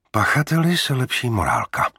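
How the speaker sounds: mock approval of the culprit; sarcastic. A narrator's reading. Kateřina comments to the captain on the culprit, sarcastically. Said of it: "poznamenala sarkasticky".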